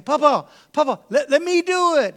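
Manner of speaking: put-on high, loud pleading kid voice; begging child. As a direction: beg like an eager little boy. Kid begging grandfather to let him.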